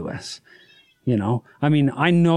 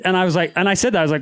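usual speaking voice

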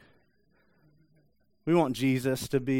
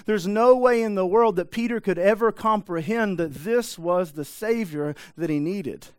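usual speaking voice

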